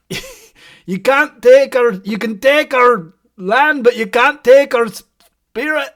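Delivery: slow, loud Braveheart battle cry; rally them in slow Scottish; Scottish accent. Shown as a demonstration. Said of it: "do a Scottish accent".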